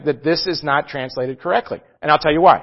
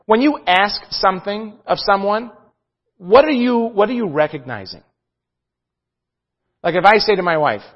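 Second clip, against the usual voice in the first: ordinary delivery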